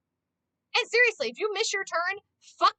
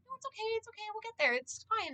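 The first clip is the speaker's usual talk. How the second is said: much softer, higher soothing voice; calm reassurance; 7 semitones higher, 9 dB quieter than usual. Retold or imitated. Passenger calming a panicking driver, soft and soothing. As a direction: hush the panic gently. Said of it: "I'm like".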